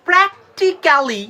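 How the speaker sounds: slow "prek-ti-ka-li" for প্র্যাকটিক্যালি; full "ka-li" ending on প্র্যাকটিক্যালি; wrong pronunciation. Shown as a demonstration. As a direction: break প্র্যাকটিক্যালি into every syllable. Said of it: "কথাটা বলবেন না".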